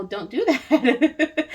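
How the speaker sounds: slow, high and loud little-one plea; a child's stretched-out warning; little one's voice. Retold or imitated. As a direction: voice the baby telling mama no. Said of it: "that's what your little one's energy is like".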